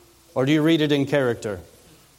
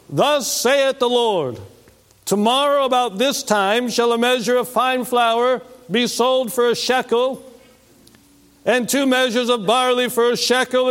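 raised, proclaiming prophet voice; mock biblical prophet; 6 semitones higher than usual. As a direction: declaim it like an Old Testament prophet. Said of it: "What would a prophet sound like to you?".

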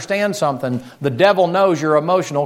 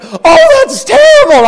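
much higher, much louder exaggerated wail; hammed-up sympathy; 10 dB louder, slower than usual. Neighbor's exaggerated pity over the dead dog, high loud wail. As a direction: overdo the neighbor's pity like a cartoon. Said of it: "then he went".

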